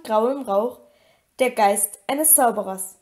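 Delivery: usual speaking voice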